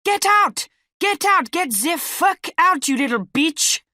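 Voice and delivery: put-on very high, loud French-accented yelling; furious shout. A narrator's reading. French flatmate shouting after fleeing guest, furious. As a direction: scream her out in a French accent. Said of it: "he shouted after me".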